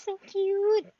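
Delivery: gushing. Real, not acted. Gushing over the cat.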